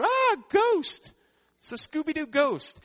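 hammed-up high ghost scream; mock terror. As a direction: shriek like a cartoon scared of a ghost. Disciples freaked out seeing Jesus.

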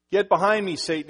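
Jesus rebuking Peter, a loud firm command; stern rebuke. Acted. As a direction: bark the quote of Jesus. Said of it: "he says".

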